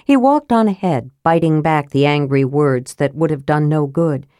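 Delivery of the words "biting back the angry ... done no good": usual speaking voice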